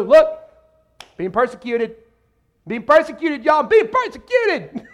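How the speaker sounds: hammed-up attention-seeking call; mock bragging. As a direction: crow it to everyone, climbing to a stretched high cry.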